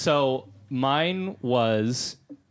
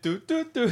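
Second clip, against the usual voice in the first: imitated dial tone; 12 semitones higher than usual. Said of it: "phone tone imitation".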